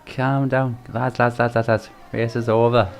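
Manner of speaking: hushed, affectionate imitation of a Welsh accent; mimicking a Welsh accent. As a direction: do a gentle Welsh impersonation. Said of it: "The Welsh accent is absolutely tremendous".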